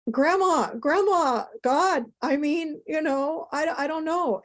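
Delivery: high, slow, choked-up cry of grandma; imitating herself breaking down in tears; retold sobbing. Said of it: "I'm crying".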